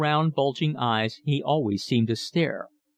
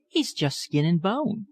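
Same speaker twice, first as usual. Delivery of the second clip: lightly voiced young girl, higher, pleading; remonstrating daughter; 7 semitones higher than usual. A narrator's reading. Sally defends Philip to her father, higher girlish protest. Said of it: "remonstrated Sally".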